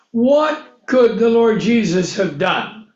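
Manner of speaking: ordinary talk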